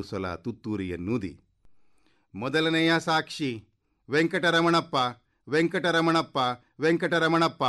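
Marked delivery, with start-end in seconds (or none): 2.3-3.6 high-pitched court crier's call. A narrator's reading. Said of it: "ಎಂದು ಕೂಗಿತು". 4.1-7.6 high, loud summons by name; calling out. A narrator's reading. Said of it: "ಎಂದು ಕೂಗಿತು".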